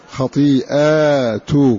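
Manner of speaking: خطيئاتكم slow, long "aa", then "tu" on ت; damma on taa. Shown as a demonstration. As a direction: recite خطيئاتكم slowly as "khatii'aatukum". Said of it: "ورفع التاء".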